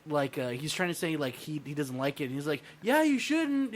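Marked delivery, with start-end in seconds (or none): 2.8-3.7 higher-pitched mock heckler interjection; mock heckling. Audience member cutting into the comic's story, mock heckling.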